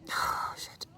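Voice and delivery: choked whisper. Real, not acted. Overcome remembering friend's kindness.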